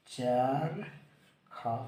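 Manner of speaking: चरखा stretched very slowly, each syllable held; teacher slowly stretching चरखा for the class; drawn-out demo word. Shown as a demonstration. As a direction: draw out चरखा so every syllable is heard. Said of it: "तो क्या लिखेंगे?".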